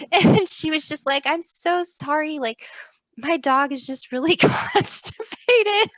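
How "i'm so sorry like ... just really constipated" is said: higher, slow sheepish apology; imitating apologetic neighbor. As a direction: apologize like it is perfectly normal.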